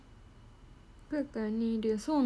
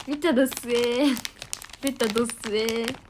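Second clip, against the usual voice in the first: playful mock Kyoto dialect; 8 dB louder than usual. Reading a comment in playful Kyoto dialect.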